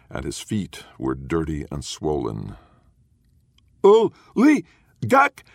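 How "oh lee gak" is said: shrill, high, loud mocking shriek; mocking children's scream. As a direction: screech it like jeering kids taunting a stranger. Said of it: "the children screamed at him, mocking".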